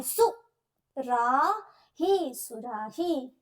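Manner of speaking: सु, रा, ही spoken slowly, each syllable apart, then सुराही joined up; slow teacher's syllable breakdown. Shown as a demonstration. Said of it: "तो हमारी ध्वनि कैसी आई?".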